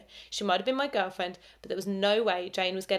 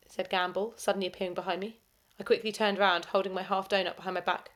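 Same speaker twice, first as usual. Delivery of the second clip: plain reading voice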